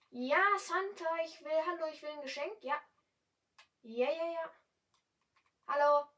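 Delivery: high, loud, eager begging; friendly to Santa. Pretend. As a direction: wheedle Santa for a present. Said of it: "wenn man nur freundlich zum Santa ist". (hoch und laut, eifrig bettelnd beim Santa)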